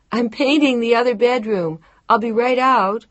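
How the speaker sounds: high and loud from another room; calling out. A narrator's reading. Woman calls from the bedroom she is painting, loud. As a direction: call it out while busy painting. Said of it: "she called out to him from the back".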